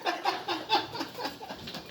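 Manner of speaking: high giggling laugh. Real, not acted. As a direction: burst out laughing. Gloating after leaving.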